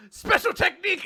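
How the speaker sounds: high, loud attack-name shout; battle cry. Acted. Recapper acts the girl announcing her move, mock battle cry. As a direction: call out a special move, hammed-up.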